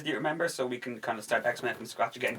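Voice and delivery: ordinary talk, nothing marked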